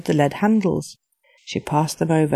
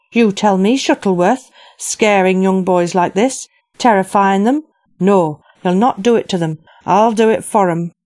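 louder furious confrontation; 2 semitones higher, 4 dB louder than usual. Acted. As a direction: let the rage spill out at him.

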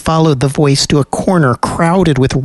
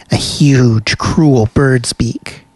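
plain reading voice

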